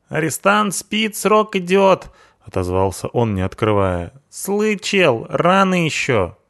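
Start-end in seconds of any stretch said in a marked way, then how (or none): 0.1-2.1 hoog, rauw punkersstemmetje, spottend; punk sidekick voice. Acted. 4.3-6.4 hoog, rauw punkersstemmetje, spottend; punk sidekick voice. Acted.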